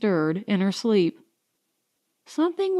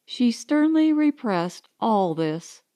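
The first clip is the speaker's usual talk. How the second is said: plain reading voice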